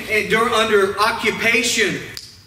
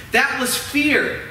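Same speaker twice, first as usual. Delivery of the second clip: normal talking voice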